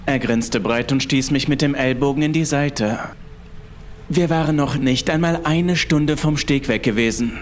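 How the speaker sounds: ordinary delivery, nothing marked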